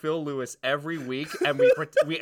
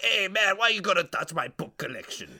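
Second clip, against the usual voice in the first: high-pitched oddball yell; put-on voice of an angry book collector, raised high; yelling character; 6 semitones higher than usual. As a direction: accost a stranger in a silly character voice. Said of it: "he just starts yelling".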